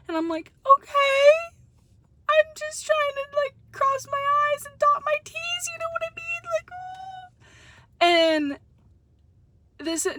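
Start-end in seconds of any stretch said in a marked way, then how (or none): 0.7-7.3 much higher, squeaky overly polite plea; mock high polite voice. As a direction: push it way up high like a nervous people-pleaser. Retold polite plea to staff.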